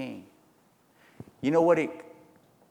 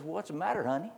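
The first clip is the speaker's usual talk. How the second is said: higher, softer coaxing wife voice; sweet Jezebel; 6 semitones higher, 6 dB quieter, faster than usual. Acted. Wicked queen soothes her sulking husband, high and soft. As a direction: coo it like a scheming queen.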